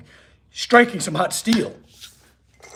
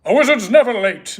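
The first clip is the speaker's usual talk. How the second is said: Gandalf quote; 5 semitones higher, 4 dB louder than usual. Retold or imitated. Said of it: "That's some, like, Gandalf stuff".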